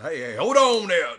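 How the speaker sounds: loud, high acted call. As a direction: holler to halt someone. Acting man calling out to Blue.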